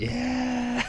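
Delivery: a high, happy yeah mid-swing; thrilled yeah. Real, not acted. Enjoying the park swing, high delighted yeah. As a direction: sing out the yeah with joy.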